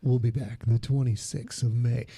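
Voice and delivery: deep, low, smooth announcer; sexy announcer voice. Pretend. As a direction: purr it like a late-night radio host. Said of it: "my sexy announcer voice".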